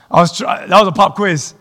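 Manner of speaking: chuckling through the words; laughing. Real, not acted.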